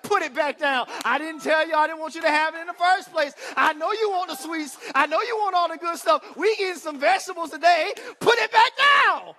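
put-on loud, rising scolding at a grabbing child; scolding parent God. God scolds the child grabbing sweets, loud shout. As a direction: build to a high shout on the last put it back down. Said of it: "God is slapping you on your hand like".

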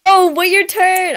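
high, loud, drawn-out protest; indignant shout. Real, not acted. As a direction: call them out for cutting in line. Scolds a player cutting in.

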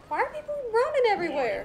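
panicked rushed question; alarmed question. Real, not acted. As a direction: blurt it out in alarm. Confused by chaos.

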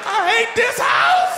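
put-on a kid wailing after a hard scrub. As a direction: wail it to the ceiling. Kid wails.

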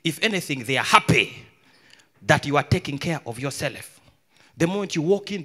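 ordinary delivery

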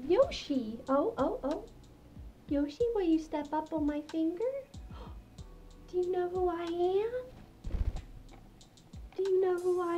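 high, gentle coaxing voice; high cooing to pets; gentle pet talk. Real, not acted. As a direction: coax a shy bird with high little questions. Coaxes her pet birds.

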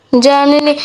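ordinary talk

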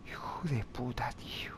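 whispered curse, barely voiced; cursing under breath. Real, not acted. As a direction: hiss the insult under your breath. Curses at game enemy. (maldiciendo entre dientes)

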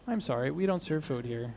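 low, very quiet apologetic refusal; hushed, low punchline delivery; deadpan bartender. Acted. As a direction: drop right down for the bartender's regretful line. Joke bartender turns customer away.

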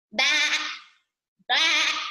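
short bleats like a sheep; sheep bleating. A narrator's reading. Teacher voicing the sheep in a story, bleating. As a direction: bleat it twice, with a pause. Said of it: "bleated the sheep".

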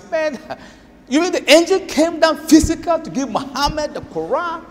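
incredulous skeptic. Retold or imitated. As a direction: raise the voice in mock amazement.

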